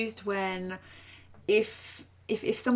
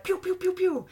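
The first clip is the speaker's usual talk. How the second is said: laser sound effect; 9 semitones higher than usual. Pretend. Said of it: "I could be like".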